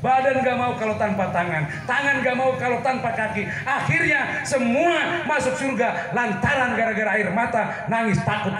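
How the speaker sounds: building, forceful sermon delivery; emphatic preaching. Acted. Preacher telling how body parts all entered paradise, emphatic build. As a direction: drive the list up to its climax.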